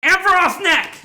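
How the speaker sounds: emphatic order. Retold or imitated. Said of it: "You're like".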